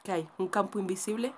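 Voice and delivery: ordinary delivery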